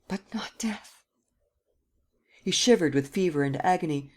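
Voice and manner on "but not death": breathless, hushed dread; Gérard whispers his fear of death; terrified whisper. Acted. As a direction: barely breathe the words. Terrified plea ends in whisper.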